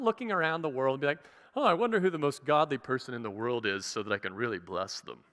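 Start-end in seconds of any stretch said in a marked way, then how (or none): 1.6-5.2 lower, oily, self-satisfied musing; hammed-up smarmy voice, lower; smarmy God. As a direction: play a smug, smarmy deity choosing a favorite. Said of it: "he's smarmy. God talks like that".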